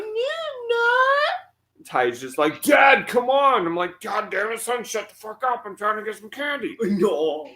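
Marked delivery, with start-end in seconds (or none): 0.0-1.9 very high, drawn-out put-on voice; squeaky fake denial. Acts out his denial when challenged. 2.6-3.6 high, loud, drawn-out mock whine; pleading kid. Voices son pleading with dad. 4.0-6.8 higher-pitched mock telling-off; mock exasperated dad. Voices himself snapping back at son.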